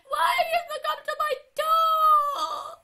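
very high, slow crying wail. Real, not acted. Player wailing at the monster at the door, crying. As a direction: wail it out in despair.

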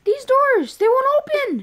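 high, loud, frightened wail; panicked cry. Pretend. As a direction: cry it out in alarm. Repeats it in alarm.

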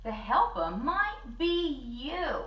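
usual speaking voice

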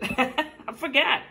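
giggling through the words; laughing. Real, not acted. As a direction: laugh while saying it. Laughing at own mispronunciation.

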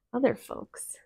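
ordinary delivery

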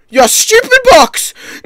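high, loud outburst at the game; frustrated yelling. Real, not acted. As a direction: yell at the thing that ruined it. Rages at box in the game.

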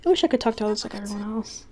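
reading game dialogue. Acted. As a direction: read the visual novel lines aloud, a touch acted. Reading game story dialogue aloud.

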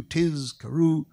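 "Tizkaru" slowly broken as Tiz, then karu; word split in two. Retold or imitated. Imitating a worshipper struggling through the Shema, split word. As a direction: labour over "Tizkaru" in two pieces, buzzing the z. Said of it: "the word is divided into two".

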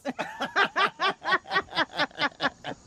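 long high giggle; high laugh. Real, not acted. Laughing at own repeated phrase.

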